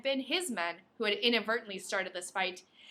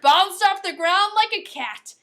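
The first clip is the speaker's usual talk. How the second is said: higher, louder and slowed for the big moment; excited narration; 9 semitones higher, 11 dB louder than usual. A narrator's reading. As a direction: punch the action hard. Exciting action beat read aloud.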